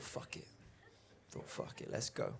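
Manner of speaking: hushed, voiceless muttering to himself; whispered resolve. Retold or imitated. Comedian deciding to go to the party, whispered pep talk. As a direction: talk yourself into it under your breath.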